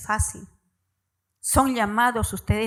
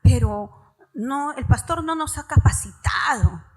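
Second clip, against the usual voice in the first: mock protest; 5 semitones higher, 5 dB louder than usual. Church members objecting to the pastor, loud mock protest. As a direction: throw the members' excuse back at them, half shouting. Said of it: "Muchas veces me dicen".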